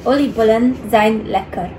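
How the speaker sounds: called out loud and happy; loud cheerful shout. Real, not acted. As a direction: shout it happily over the party. Shouted at party at home.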